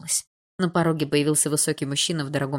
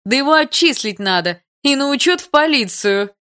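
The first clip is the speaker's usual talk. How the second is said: high, loud furious rant; shrieking indignation, piling up accusations; outraged tirade; 7 semitones higher, 7 dB louder than usual. Acted. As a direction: berate him at full pitch, scandalised. Outraged mother rejects compensation offer.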